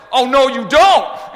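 loud high slow shout; dad yelling at biting dog; angry dad shout. Retold or imitated.

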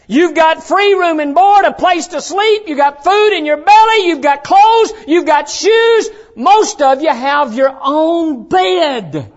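loud, high list hammered out item by item; full-force preaching. Real, not acted. Preacher counting off the kids' blessings, full-force preaching. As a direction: drive each point home from the pulpit.

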